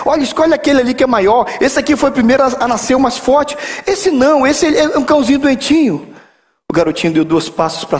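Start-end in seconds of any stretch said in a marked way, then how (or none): 0.1-6.1 dono da ninhada levemente interpretado, tom mais agudo. Acted. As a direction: um leve tom de quem aconselha o menino, voz aguda. Dono da ninhada fala ao menino. (dono da ninhada, voz levemente mais aguda)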